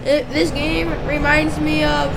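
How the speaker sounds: P=A; loud, yelling. Real, not acted. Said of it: "I don't know why I'm yelling".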